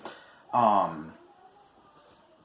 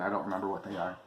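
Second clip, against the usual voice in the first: usual speaking voice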